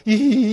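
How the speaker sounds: high, mock toothless giggle; old granny's laugh. Monk describing the bride turned hag to listeners, imitated granny laugh. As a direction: imitate a grinning crone's hee-hee. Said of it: "이래 웃고 있으니까".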